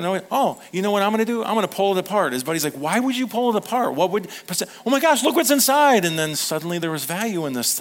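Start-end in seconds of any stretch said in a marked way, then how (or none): 0.3-2.3 quick, bright and higher; eager scheme. Retold or imitated. Pastor voicing the finder's eager plan, quick and higher. 2.7-6.0 doubting then bursting into a loud high gasp; buddy's amazement. Retold or imitated. Pastor playing the buddy, doubting then loudly amazed.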